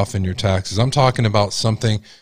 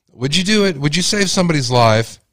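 impassioned question; 6 semitones higher than usual. Real, not acted. Climactic rhetorical question.

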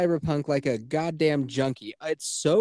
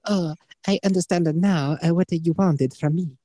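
put-on higher-pitched caricatured Chinese accent; mock Chinese accent; 5 semitones higher, 3 dB louder than usual. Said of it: "Chinese woman?".

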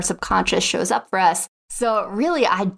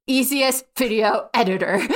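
"Easiest video editor" said a bit higher, like an eager search; mock-eager; 3 semitones higher than usual. Recalling hunting for a beginner editor, mock-eager. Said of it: "I was like".

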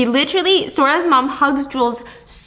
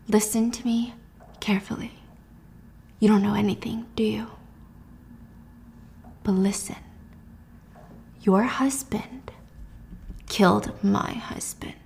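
menacing whisper; 8 dB quieter than usual. Acted. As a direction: whisper it with deliberate pauses. Acted confrontation, grabbing her wrist.

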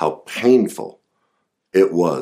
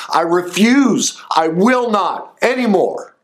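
angry venting; 13 semitones higher than usual. Shown as a demonstration. Therapist demonstrating rage at parents, furious shout. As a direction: let the rage out at the parent who shamed you. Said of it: "We should be angry".

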